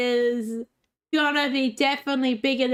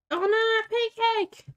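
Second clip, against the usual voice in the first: high sudden oh no; quick high startled cry; surprised yelp; 10 semitones higher than usual. Real, not acted. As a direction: yelp in alarm. Sees pancake mishap.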